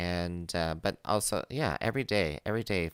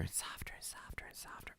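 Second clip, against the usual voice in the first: getting softer; 19 dB quieter than usual. Shown as a demonstration. Host demonstrates how the audio kept dropping, softer into whisper. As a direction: voice fading down into a whisper. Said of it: "I just kept going softer".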